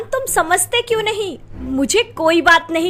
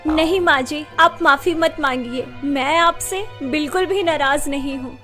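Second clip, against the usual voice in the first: gentle forgiveness; 3 semitones higher than usual. Acted. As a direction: soothe her, lowering the voice. Gently forgiving her mother-in-law.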